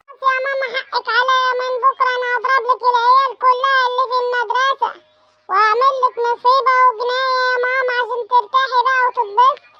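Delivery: put-on high sarcastic little boy; a kid promising mischief to shut mom up; child voice. Son sarcastically placating his mother, high child voice.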